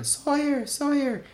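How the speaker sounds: high-pitched calling of the name across a room; mock excited call, high pitch. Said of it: "screaming my name".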